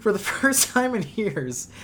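choking up while reading; breaking down into a coughing laugh; choking on laughter. Real, not acted. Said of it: "I'm choking again".

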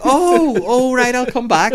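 high, loud, put-on princess voice; startled character backing off; Mulan. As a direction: pitch it way up, caught out mid-act. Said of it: "And she's like".

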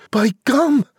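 very high-pitched, monotone character cry; Jack crying out. A narrator's reading. Jack reporting that the giant ate his mum, high cry. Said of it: "Jack cried".

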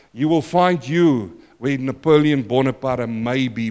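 normal talking voice

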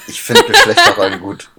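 laughing. Real, not acted. Provocative joke, bursts out laughing.

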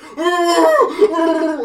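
high, very loud hysterical cackle; crazed shriek laugh. Retold or imitated. Mimicking the actor's fit in the movie, loud hysterical shriek. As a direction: shriek like a man losing his mind. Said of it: "the actor, whoever he is, is just like".

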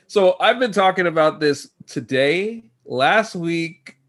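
ordinary delivery

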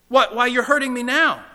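high, loud cry of hurt; pained protest. Acted. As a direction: stammer out a loud, alarmed complaint. Ghost protests being hurt by angel.

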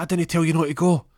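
loud, high, indignant comeback; acted angry retort. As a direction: throw the blame back at him heatedly. Wife hits back in quarrel.

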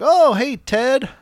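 high, bright supermarket hello; mock-friendly hey to a neighbor; cheery neighbor greeting. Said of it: "you're like".

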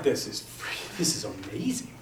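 breathless whispered wonder; hushed, amazed whisper; awed whisper. Retold or imitated. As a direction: whisper it, stunned by the sunset. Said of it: "I'm like".

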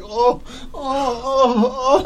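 high, mock tearful moans. Brother climbing out holding his back, mock crying moans. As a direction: imitate someone moaning over a hurt back. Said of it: "he's walking like a little old man".

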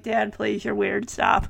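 softly exasperated teen, imitated; eye-rolling teenager. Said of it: "I would usually roll my eyes like".

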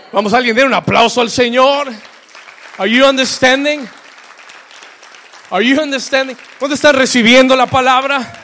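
loud, high call to the congregation; rousing preaching. Real, not acted. Preaching at full force. (arenga de predicador en voz alta)

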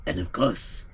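usual speaking voice

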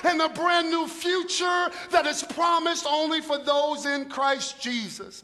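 shouted, slow, fiery sermon voice; Paul preaching. Retold or imitated. Paul shouting hope to the believers, fiery and slow. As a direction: proclaim it like the apostle Paul would. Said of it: "he would go".

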